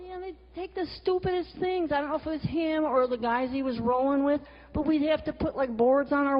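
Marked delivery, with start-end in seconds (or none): none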